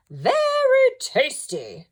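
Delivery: very high, slow, savouring voice; satisfied dog Walter. A narrator's reading. Walter pleased with the biscuits, slow and high. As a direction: relish every word. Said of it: "said Walter to himself".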